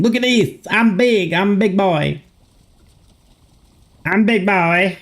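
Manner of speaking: playful high-pitched goofy voice; silly high voice. Pretend. Streamer goofing as his stretched image fills the screen. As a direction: squeak it like a giddy kid.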